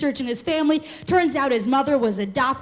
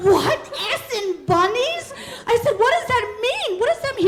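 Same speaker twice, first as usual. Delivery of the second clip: high, incredulous questions; baffled disbelief; 10 semitones higher than usual. Retold or imitated. Girlfriend reacting to the reading, high incredulous laughing.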